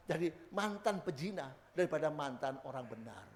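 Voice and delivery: ordinary talk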